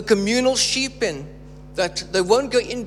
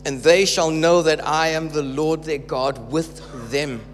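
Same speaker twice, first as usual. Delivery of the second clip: measured, weighty scripture reading with slowed phrases; 4 semitones lower than usual. Acted. Preacher reading Ezekiel aloud to church, measured proclamation. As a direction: proclaim God's words to the congregation.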